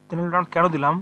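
ordinary delivery

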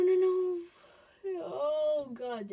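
ordinary delivery

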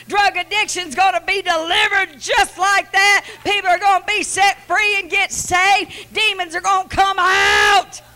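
high, loud preaching; preaching at full force. Real, not acted. Wife preaching the sermon to her husband, loud and high. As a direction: preach it out, building each line.

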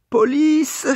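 very high, loud call for police; shout for police. Acted. Someone in the street calling loudly for the police. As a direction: cry out for help.

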